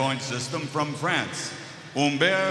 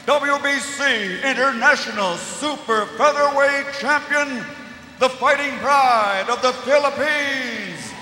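boxing ring announcer; 4 semitones higher than usual. Acted. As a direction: rattle off the record, then explode on the name. Fighter introduction.

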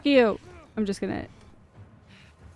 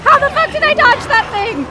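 high, loud disbelief; shouted, high-pitched amazement; astonished shout; 13 semitones higher, 8 dB louder, faster than usual. Real, not acted. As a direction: yell it, amazed to have survived. Astonished at own dodge.

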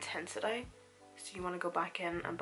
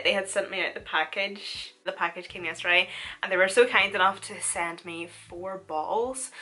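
ordinary delivery

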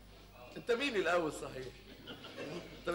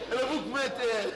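ordinary talk

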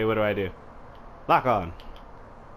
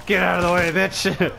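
high, raised order; playful battle shout; 7 semitones higher, 3 dB louder than usual. Pretend. Player pushing through soldiers, playful shout. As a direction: holler it while fighting through.

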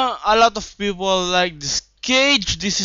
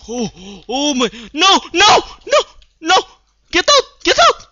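high loud panicked get out; frantic yelling; 7 dB louder, faster than usual. Real, not acted. Gamer under attack, frantic high yelling.

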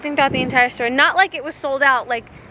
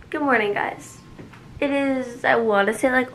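usual speaking voice

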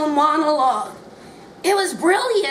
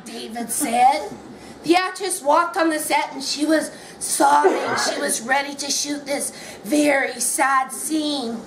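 plain reading voice

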